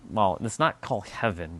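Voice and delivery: normal talking voice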